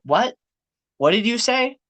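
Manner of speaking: high, puzzled "what did you say"; confused. Retold or imitated. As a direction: play the baffled classmate. Imitating confused classmates.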